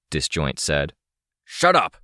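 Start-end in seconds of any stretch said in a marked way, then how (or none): 1.4-2.0 sharp, high-pitched snap; curt, angry command; barked order. A narrator's reading. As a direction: cut them off with a bark. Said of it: "Shortcut barked".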